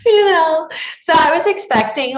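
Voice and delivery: loud, high words still laughing. Real, not acted. Laughing loudly to her co-host about ratings. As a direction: laugh through it at her own softness.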